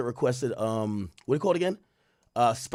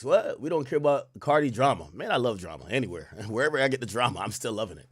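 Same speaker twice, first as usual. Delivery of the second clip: ordinary talk, nothing marked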